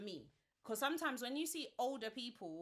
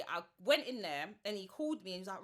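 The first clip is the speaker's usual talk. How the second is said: normal talking voice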